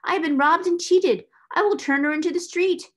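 high, loud outburst; furious. A narrator's reading. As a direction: lash out in loud indignation. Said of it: "If she had not been so furious".

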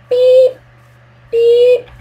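high, bright beep sound in place of a swear; mock censor beep; self-censor beep. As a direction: bleep yourself out, pitched high. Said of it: "I'm just gonna swear".

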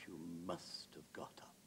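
hushed, near-whispered punchline; softly teasing Wilde; confidential tease. Acted. Wilde teases his friend, acted.

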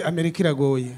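mock lament. Preacher voices discouraged immigrants complaining.

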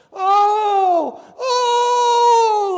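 high, loud imitated mourners' wail; mock wailing. Mourners wailing at the graveside, high loud wail. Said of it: "like they were miserable".

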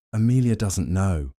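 ordinary delivery, nothing marked

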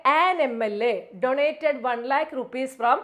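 slow, careful reading stressing an; slow read-aloud. Shown as a demonstration. Teacher reading the answer slowly to students. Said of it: "teacher reads corrected sentence".

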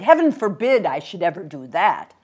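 put-on loud, flustered old gentleman; emphatic, scandalised denial; crying out in protest. Old man rejects the suggestion, exclaiming loudly. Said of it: "he cried".

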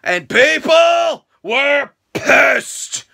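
drawn out and loud for effect; slow, loud, high declaration; slow emphatic line. Real, not acted. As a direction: stretch it out to stress the outrage.

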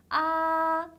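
아 held high and level; first tone. Shown as a demonstration. Teacher showing first tone, 아 high and level. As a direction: stretch 아 straight along one high note. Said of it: "높은 음에서 쭉 이어지는 음이에요".

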